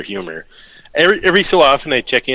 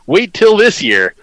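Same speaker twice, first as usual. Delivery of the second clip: louder, slow mock prophecy; hammy punchline read; 8 semitones higher, 5 dB louder, slower than usual. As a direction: deliver it like a grand prophecy. Reading a joke punchline.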